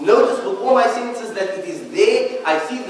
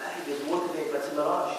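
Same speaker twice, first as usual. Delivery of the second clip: soft, low acted exclamation; much softer, low mock discovery; hushed aside; 7 semitones lower, 13 dB quieter, faster than usual. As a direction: voice the traveller quietly, under your breath. Voicing a desert traveller seeing water.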